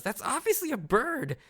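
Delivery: high indignant yell. Retold or imitated. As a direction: shout it, exasperated. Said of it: "Isa just yells".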